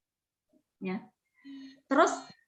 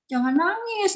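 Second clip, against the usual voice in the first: dismissive parent; 7 semitones higher than usual. Retold or imitated. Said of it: "jangan dibilang".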